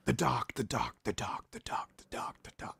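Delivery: low voice fading into a whispered echo; Doctor Disrespect. Retold or imitated. Imitating the streamer's intro, self-made echo fading out. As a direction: repeat it as your own echo, dying away. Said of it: "doing his own echo as it goes in".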